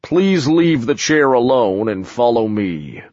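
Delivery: lightly voiced giant, steady; calm big man giving orders; dim slow booming giant. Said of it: "the giant boomed".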